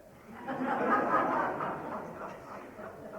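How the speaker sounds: a short, real breathy laugh at the punchline. Real, not acted. Laughing at his friend's Canada joke, real short laugh. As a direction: let the laugh spill out after the joke.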